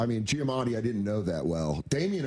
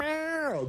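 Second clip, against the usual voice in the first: high mock meow. Imitating Giamatti's acting.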